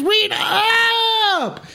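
very high, yelled catchphrase; hype shout. Real, not acted. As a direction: scream it for the crowd.

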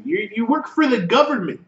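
high-pitched acted rebuke; hammed-up telling-off; mock scolding. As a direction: point out the obvious, play-acting exasperation. Acted police scolding the suspect.

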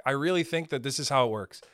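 ordinary talk

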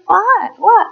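high-pitched clueless "what?"; puzzled, high mock questioning; mock oblivious. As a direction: play the parent who notices nothing. Oblivious parent acted in story.

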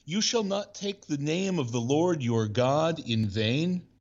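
a measured, formal Bible reading; scripture reading. Acted. Reads scripture text.

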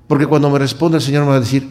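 usual speaking voice

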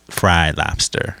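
ordinary talk, nothing marked

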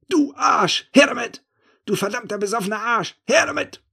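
high, loud frantic thief's shout; wütend beschimpfend. A narrator's reading. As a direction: spit the insults at him in a frenzy. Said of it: "beschimpft mich". (hohe, laute, wütende Räuberstimme)

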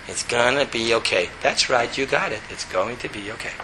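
just repeat it calmly, no drama; quiet monotone. Shown as a demonstration. Answering the panic inside, quiet monotone. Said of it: "quietly, monotone".

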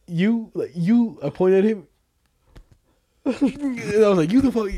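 high, loud pointed "you"; retold accusation. Showing a friend how he confronted the driver, retold accusation. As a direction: re-enact singling out the offending driver.

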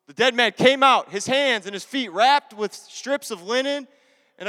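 loud, slow, dramatic Bible reading; preacher's scripture reading. Acted. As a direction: proclaim it with measured force, easing at the end.

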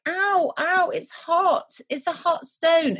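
high, drawn-out mock ouches; mock pained yelping. Retelling how Jemmy holds the hot stone, mock pained. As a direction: play-act a burnt hand, high and slow.

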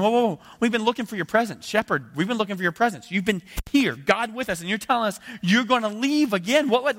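high, loud panicked protest; frantic pleading as an upset follower; freaking out. Retold or imitated. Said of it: "they're really freaking out".

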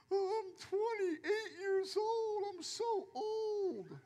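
high, slow, whimpering mockery of a self-pitying youngster; mock whining. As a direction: whine it out like a crybaby, hammed-up. Mocking twenty-somethings who feel old.

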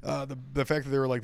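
ordinary talk, nothing marked

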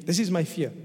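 normal talking voice

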